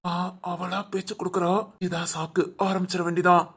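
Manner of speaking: low, soft scheming to himself; private aside. Acted. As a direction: think aloud under your voice. Private aside before confronting daughter-in-law.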